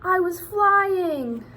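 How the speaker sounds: high, loud and slow, marvelling; slow, soaring thrilled exclamation. Acted. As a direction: savour the feeling of flight. Thrill of the ride.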